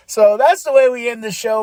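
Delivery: ordinary talk, nothing marked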